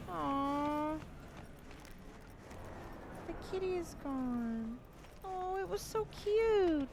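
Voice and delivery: put-on high, sing-song baby talk; reading to a toddler. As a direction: coo at the kitty like a baby. Cooing at a cat in game.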